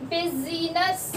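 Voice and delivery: usual speaking voice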